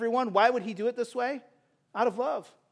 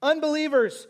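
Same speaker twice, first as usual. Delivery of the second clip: preaching at full force; 4 semitones higher than usual. Acted. Preacher urging unbelievers to the mercy seat, full-force preaching.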